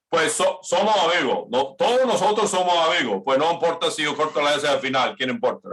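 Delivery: Caribbean Spanish, swallowing the final S; dropped final S. Retold or imitated. Teacher imitating Spanish speakers who drop the S, fast Caribbean Spanish. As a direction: rattle it off dropping every S. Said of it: "if you just kinda say".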